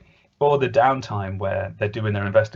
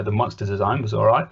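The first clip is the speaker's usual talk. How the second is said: ordinary delivery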